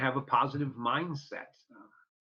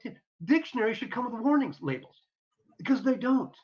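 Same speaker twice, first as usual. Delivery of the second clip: exasperated; 9 semitones higher than usual. Retold or imitated. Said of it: "And I'm like".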